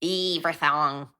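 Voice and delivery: slow, stretched "Everything" in broad accent; exaggerated accent attempt. Shown as a demonstration. Retrying a line that sounded South African, broad Australian accent. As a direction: overdo the Australian vowels in "Everything". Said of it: "that sounds more South African".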